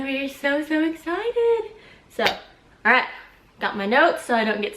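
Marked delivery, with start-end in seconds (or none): 0.0-1.7 excited. Real, not acted. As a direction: stretch it out, thrilled. Announcing baby girl's name.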